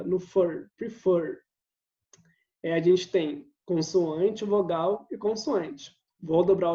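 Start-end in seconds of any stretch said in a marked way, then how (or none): 0.8-1.4 "prefer" with the stress on its last syllable. Shown as a demonstration. Teacher showing students the stressed syllable, "prefer" stressed at the end. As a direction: say "prefer", leaning on the final "fer". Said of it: "Minha sílaba tônica tá aqui na última sílaba".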